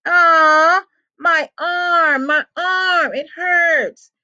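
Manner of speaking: high, hammed-up whimpering; loud start, high pained whine. A narrator's reading. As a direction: wail as if in pain, putting it on. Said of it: "whim-, whimpered".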